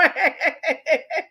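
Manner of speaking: real laugh bubbling out; laughter. Real, not acted. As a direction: crack up at your own pitch. Cracks up after asking for money.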